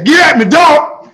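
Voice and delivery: slow, loud rapper bark; hammed-up rapper call.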